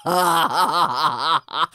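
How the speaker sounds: loud, hammed-up belly laugh; uproarious acted guffaw; soldier's mocking laughter. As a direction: burst into big derisive ha-ha-has. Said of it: "the soldier started laughing".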